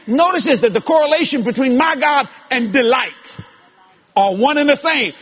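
high, loud revival-preacher cry; fiery preaching. Acted. Preacher driving home the verse to his congregation, fiery. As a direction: preach it up to the rafters.

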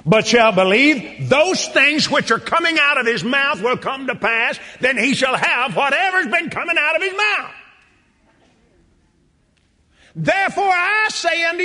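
preaching scripture. Acted. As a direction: build the verse toward its climax. Reads scripture aloud.

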